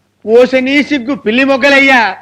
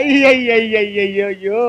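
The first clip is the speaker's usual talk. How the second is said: drawn-out lament; 4 semitones lower, 3 dB quieter, much slower than usual. Acted. Long drawn-out comic lament.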